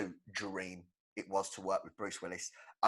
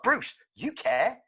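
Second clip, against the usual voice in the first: mock plea to Bruce; 11 semitones higher, 6 dB louder, slower than usual. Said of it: "And I'm like".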